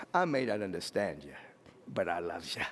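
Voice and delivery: low, soft, sincere declaration; tender reassurance. Acted. Models loving words to others.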